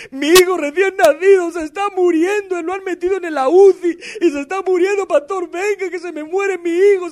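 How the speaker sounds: high, loud, frantic voice of a father begging the pastor; desperate plea. Retold or imitated. Church brother phoning the pastor about his dying son, frantic high plea. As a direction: beg like your newborn is dying, voice cracking. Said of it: "me llama un hermanito".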